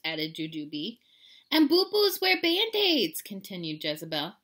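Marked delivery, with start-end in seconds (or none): 1.5-3.2 put-on high, loud, slow girlish voice; little sister. A narrator's reading. Sister continues the silly list, high girlish voice. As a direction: draw it out in a child's voice.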